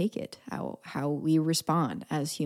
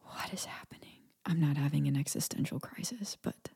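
soft unvoiced whisper; 9 dB quieter than usual. Real, not acted. Vlogger whispering to camera about sixteen years driving. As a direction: whisper it like a secret panic.

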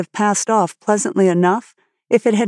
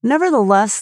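usual speaking voice

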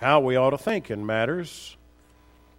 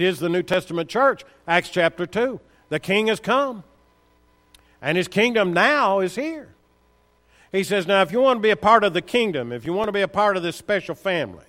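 preaching at full force; 5 semitones higher, 4 dB louder than usual. Acted. Preacher builds to a climax.

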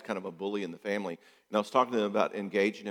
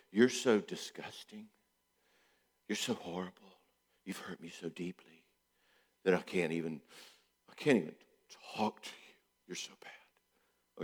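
wounded guilt-trip; 4 semitones lower, 8 dB quieter than usual. Retold or imitated. Silent partner shaming spouse, fading to hushed whisper. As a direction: sulk it out, dropping to a whisper. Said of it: "In other words".

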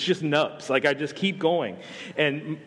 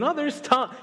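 higher, gushing fan spotting a star; starstruck fan; 10 semitones higher than usual. Retold or imitated. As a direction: gush like a fan who thinks he knows him. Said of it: "run into him at a barbecue".

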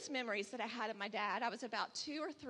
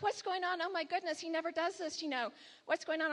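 higher-pitched mock panic; retold freak-out; 6 semitones higher than usual. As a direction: replay a worried mother's alarm. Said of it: "I'm freaking out".

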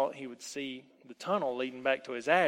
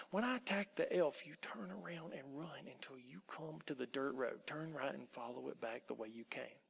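whispering; 11 dB quieter than usual. A narrator's reading. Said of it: "whispered in the boy's ear".

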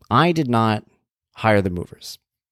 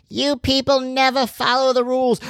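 slow, very high mock woman's voice; scolding wife. Neighbor's wife scolding the mover, slow high mock voice. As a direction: drag out a high-pitched imitation scolding.

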